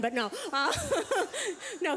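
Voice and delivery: normal talking voice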